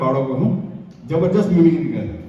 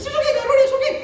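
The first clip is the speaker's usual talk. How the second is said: squeaky mock listener. High squeaky interjection.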